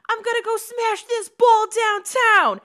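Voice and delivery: put-on high, loud tough Chicago-Italian kid; loud, high, brassy Chicago-Italian voice; Chicago Italian accent. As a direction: belt it like a brash street kid from Chicago. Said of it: "I get really Italian sounding".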